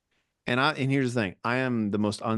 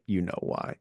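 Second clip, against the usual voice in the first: ominous eulogy line; 4 semitones lower, 5 dB quieter than usual. Pretend. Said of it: "then it's like".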